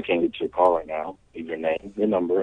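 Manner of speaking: ordinary talk, nothing marked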